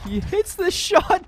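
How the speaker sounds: rising in disbelief; excited call. Real, not acted. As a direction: react to the shot live. Caster reacts to big shot.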